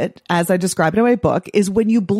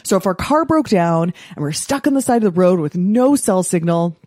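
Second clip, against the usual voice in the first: usual speaking voice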